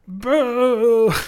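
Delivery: loud mock boo from the crowd. Fans booing the new Star Wars film, loud mock boo. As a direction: boo it like a let-down fan.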